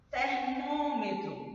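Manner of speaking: "Termômetro" drawn out on a high, closed "mô"; closed stressed vowel. Shown as a demonstration. Teacher showing the tonic syllable, "Termômetro" slowly with closed ô. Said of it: "Olha o som".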